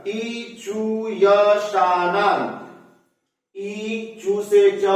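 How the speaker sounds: sutra stretched out word by word; very slow, deliberate. Shown as a demonstration. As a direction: drag each syllable so students catch it. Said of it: "ध्यान से समझिएगा". (बहुत धीरे, एक-एक शब्द पर ज़ोर)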